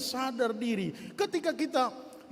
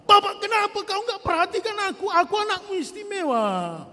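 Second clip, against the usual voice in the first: higher, louder wailing complaint; whiny lament; 11 semitones higher, 6 dB louder than usual. Retold or imitated. Israelites crying to God, higher, louder wailing. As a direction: wail like a spoiled child who feels abandoned.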